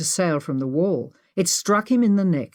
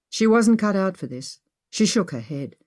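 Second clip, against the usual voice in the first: ordinary delivery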